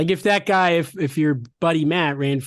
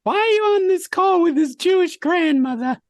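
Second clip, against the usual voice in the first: grandmother voice; 3 dB louder than usual. Acted. Grandmother bit continues.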